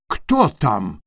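fox shouting in a put-on thick voice. A narrator's reading. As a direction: bluff a thick voice through the door. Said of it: "закричала лиса толстым голосом".

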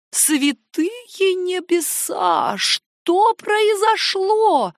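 high, drawn-out cry of dismay; alarmed exclamation. A narrator's reading. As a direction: exclaim loftily, stretching each word. Aunt alarmed at guest's arrival. (высокий протяжный возглас тревоги)

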